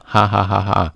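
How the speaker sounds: spoken-out "ha ha" laugh; mocking Hulk laugh. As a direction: imitate Hulk laughing at someone. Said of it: "Hulk starts pointing at Rocket".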